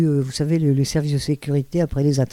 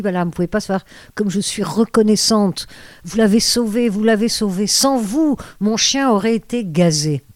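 acted gushing thanks, pitched high; effusive gratitude; 5 semitones higher than usual. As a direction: replay overflowing thanks, voice lifting higher with each phrase. Effusive thanks to the German lady. (remerciements éperdus rejoués, voix haut perchée)

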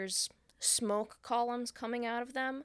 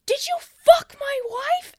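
slow, much louder and higher mock yell; retold yell; 12 semitones higher, 11 dB louder, slower than usual. Man yelling at his mirror, mock furious yell. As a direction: scream it at the mirror. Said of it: "And he's yelling".

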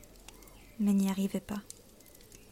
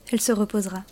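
ordinary delivery

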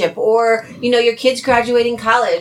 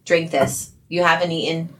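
gentle caring; 6 semitones lower than usual. Retold or imitated. Retold caring friend.

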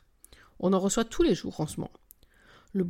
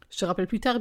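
ordinary delivery

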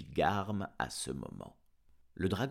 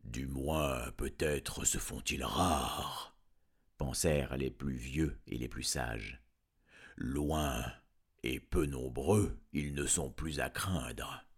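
lower, slow, sage dragon voice; old wise dragons; 6 semitones lower, slower than usual. Acted. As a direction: ponder it like an ancient dragon. (vieux dragon sage, voix grave et lente)

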